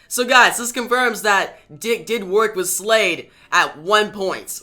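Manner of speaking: eager fan reveal; telling viewers Dick worked with Slade; excited. Real, not acted. Narrator excited by comic reveal.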